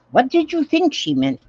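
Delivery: usual speaking voice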